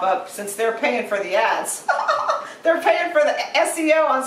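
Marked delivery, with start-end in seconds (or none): 1.9-2.6 high, quick chuckle; brief burst of giggles; short laugh. Real, not acted. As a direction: laugh briefly at your own quip. Laughing at own remark.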